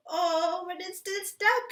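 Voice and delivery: usual speaking voice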